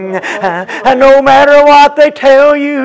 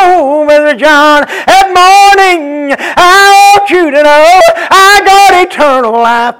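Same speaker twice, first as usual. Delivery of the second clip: higher, slowed, sung-out whoop with a crying edge; whooped preaching with a cry; 7 semitones higher, 5 dB louder than usual. Acted. Pastor proclaiming his eternal life to the brethren, crying whoop.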